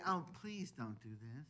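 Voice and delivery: soft, timid begging voice; mock meek plea. Preacher mocking a weak request to the church, timid plea. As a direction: plead weakly, almost apologizing. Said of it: "He doesn't say".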